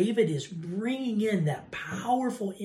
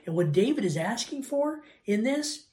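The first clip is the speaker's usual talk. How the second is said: ordinary talk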